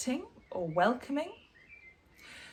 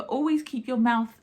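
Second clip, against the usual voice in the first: ordinary delivery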